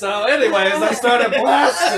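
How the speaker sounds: slow, loud laughing delivery. Real, not acted. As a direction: laugh it out slowly.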